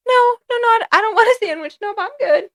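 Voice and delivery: very high, hurried squeaky protest; comic squeal of polite panic; mock nervous refusal. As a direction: decline in a high, rattled voice. Acting out refusing yelling grandmother's sandwich.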